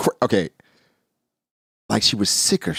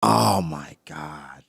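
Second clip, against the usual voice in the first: slow, breathless whispered oh my God; awed hushed disbelief; stunned whisper; 9 semitones lower, 6 dB quieter, much slower than usual. Real, not acted. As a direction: gasp it out under your breath, slowly. Stunned reaction to chapter reveal.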